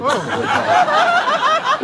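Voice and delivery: high, slow words through laughter; laughing. Real, not acted. Actor telling the SpongeBob story, laughing. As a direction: crack up mid-sentence. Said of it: "laughs at own story".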